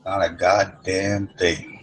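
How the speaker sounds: to the cop, slow and unbothered; slow deadpan. Retold or imitated. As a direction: let each word land, unbothered. Speaker's slow defiant reply.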